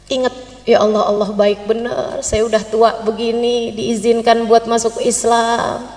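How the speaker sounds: low devotional musing; moved gratitude. Acted. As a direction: marvel gratefully to yourself, unhurried. Pilgrim's inner prayer while circling.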